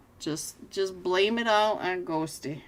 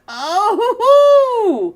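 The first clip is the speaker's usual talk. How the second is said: big laugh; 14 semitones higher, 14 dB louder than usual. Real, not acted. Caster cracking up at a fight, loud laughter. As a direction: burst of loud high laughter.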